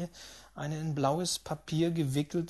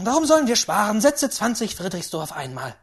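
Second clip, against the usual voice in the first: loud burst of resolve; excited old lady; reckless great-aunt; 6 semitones higher, 10 dB louder, faster than usual. Acted. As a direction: order the big bet boldly. Great-aunt raises the stake.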